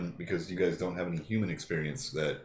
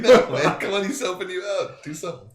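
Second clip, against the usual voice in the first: urgent plea; 10 semitones higher, 8 dB louder than usual. Retold or imitated. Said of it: "We're like".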